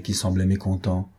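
plain reading voice

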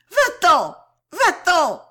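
very high, very loud screamed order. A narrator's reading. Girl striking and pushing him away, furious scream. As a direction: scream him out of the room, stamping. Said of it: "elle cria plus fort, en trépignant plus que jamais".

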